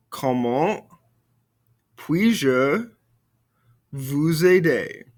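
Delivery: Comment, puis-je, vous aider said slowly word by word; slow syllable drill. Shown as a demonstration. Teacher drilling the class in slow separate chunks. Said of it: "Répétez-moi".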